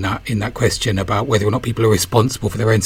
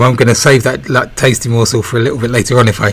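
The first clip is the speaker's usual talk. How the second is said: usual speaking voice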